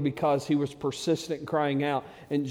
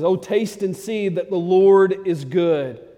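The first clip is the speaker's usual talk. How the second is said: slow and louder, savoring every word; David thrilled inviting everyone to taste God's goodness; excited; 4 semitones higher, 8 dB louder, slower than usual. Retold or imitated. Preacher voices David in slow, louder excitement. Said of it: "Man, he's so excited".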